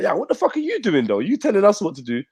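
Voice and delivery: high-pitched mock indignation; indignant challenge. Bobby objecting to being given orders, indignant. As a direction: imitate someone bristling at being bossed.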